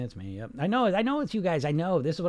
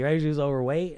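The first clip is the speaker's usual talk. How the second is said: ordinary talk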